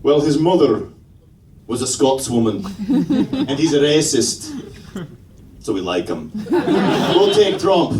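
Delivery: put-on comic Scottish accent. Comedian as the Scots approving Trump, Scottish accent. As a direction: weigh it up, then decide, in a Scottish accent. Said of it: "The Scots are back there going".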